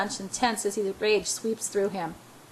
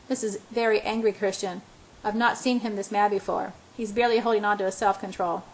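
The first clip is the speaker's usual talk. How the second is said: plain reading voice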